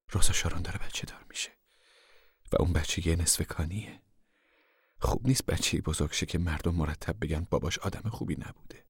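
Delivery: soft, hushed explanation; gentle, reasoning mother. Acted. Mother asks Pa to spare the unborn child, softly. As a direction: reason with him quietly.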